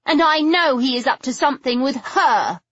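high, resentful voice; bitter accusation. A narrator's reading. Figgy accusing Patrick over her stepmother, bitterly. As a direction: accuse him, leaning hard on the last word.

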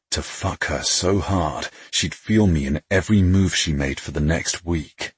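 usual speaking voice

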